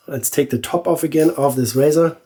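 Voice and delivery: normal talking voice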